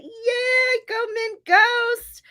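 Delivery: high-pitched, drawn-out hooray; celebratory high squeal of encouragement; excited cheer. Real, not acted. Cheering on a sprinter's edited chapter.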